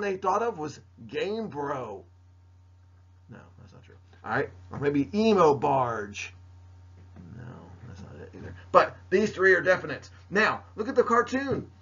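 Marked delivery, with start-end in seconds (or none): 3.3-4.0 whispered aside. Real, not acted. Muttered correction aside. 7.3-8.5 whispered aside. Real, not acted. Muttered correction aside.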